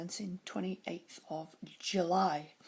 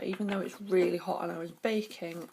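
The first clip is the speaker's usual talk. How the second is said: ordinary talk, nothing marked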